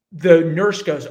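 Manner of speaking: ordinary talk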